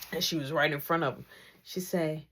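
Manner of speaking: normal talking voice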